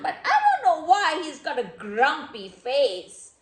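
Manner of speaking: plain reading voice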